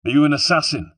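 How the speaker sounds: high, loud interrogation; demanding question. A narrator's reading. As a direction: press him for the truth. Hero interrogates his enemy.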